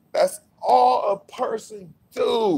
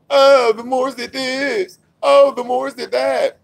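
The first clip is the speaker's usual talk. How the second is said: slow, drawn-out, higher mock voice parroting others; mocking quote; 6 semitones higher, 3 dB louder, slower than usual. Speaker mocking critics who blame the Moors, slow sneering parody. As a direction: sneer it back like a tired cliché.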